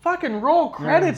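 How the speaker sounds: high, slow, emphatic demand; retold demand. Wrestling fan demanding the credits roll, emphatic. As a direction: call it out high, stretching each word. Retold reaction, shouted at the show.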